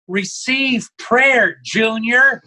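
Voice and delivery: high, slow motherly order; a mother ordering her grown son, drawn out; stern mom voice. Retold or imitated. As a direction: mom telling her drunk son to accept prayer, slowly and high. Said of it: "his mom goes".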